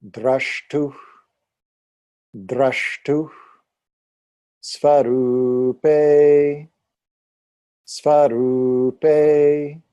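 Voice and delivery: each Sanskrit word called out twice, loud and slow. Shown as a demonstration. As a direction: call out each Sanskrit word slowly for the class to echo. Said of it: "You can repeat after me".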